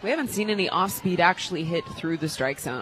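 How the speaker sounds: ordinary delivery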